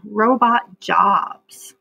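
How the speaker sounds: robot jobs read very slowly and deliberately; drawn-out read of robot jobs; reading the heading. Shown as a demonstration. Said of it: "Let's look at that heading".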